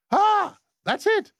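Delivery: squeaky-high playful interjections; high-pitched cries. Pretend. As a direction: throw out short very high yelps. Performing a rap blooper.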